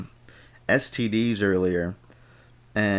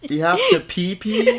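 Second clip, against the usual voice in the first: much higher, loud laughing question; laughing disbelief; 7 dB louder than usual. Real, not acted. Teasing his friend about the note, laughing. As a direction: ask it in cracking-up disbelief.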